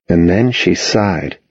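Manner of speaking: ordinary delivery, nothing marked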